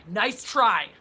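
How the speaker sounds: mocking, punched repeat; emphatic taunt. Real, not acted. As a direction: throw it back at the joker, hard. Repeats it louder to mock trolls.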